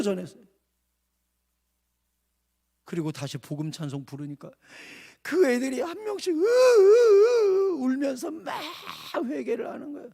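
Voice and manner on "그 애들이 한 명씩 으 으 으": high, loud drawn-out sob sounds; mock loud sobs; imitated sobbing. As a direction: wail it like a weeping child. Kids weeping in repentance.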